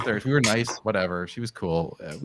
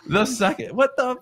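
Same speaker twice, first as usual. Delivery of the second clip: high, stammering shock; stunned disbelief; 12 semitones higher than usual. Retold or imitated. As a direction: sputter in shocked confusion. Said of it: "we're all like".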